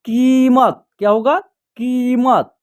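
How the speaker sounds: कीमत with a long, stretched ee in की; drawn-out first vowel. Shown as a demonstration. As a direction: hold the first syllable of कीमत, then finish the word. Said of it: "खींच करके उच्चारण होगा".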